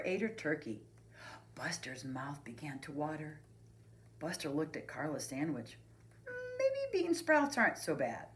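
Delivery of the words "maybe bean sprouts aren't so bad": put-on high, tentative kid voice; thinking aloud. A narrator's reading. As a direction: muse to yourself, high-pitched. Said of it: "he thought".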